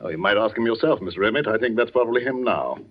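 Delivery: ordinary delivery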